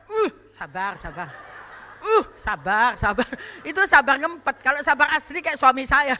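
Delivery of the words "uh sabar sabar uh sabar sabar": suppressed patience. Shown as a demonstration. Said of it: "Kalau sabar ngempet".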